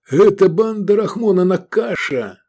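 high, loud urgent shout. A narrator's reading. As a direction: shout a warning over the noise. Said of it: "прокричал Воронов".

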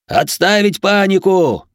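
slow, high, loud bellow; roared command. A narrator's reading. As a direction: bellow an order over the panic. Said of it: "взревел Герман".

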